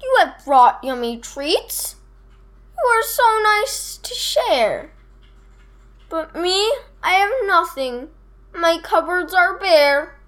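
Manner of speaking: mildly acted, forlorn bear voice; moaning Bear. A narrator's reading. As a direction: lament having nothing to give. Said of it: "Bear sighs and he moans".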